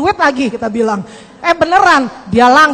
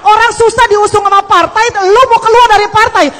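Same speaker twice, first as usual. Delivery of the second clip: higher and louder, an indignant rebuke; angry friends; 6 semitones higher, 5 dB louder than usual. Retold or imitated. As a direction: scold him like exasperated party friends. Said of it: "teman-temannya pada marah".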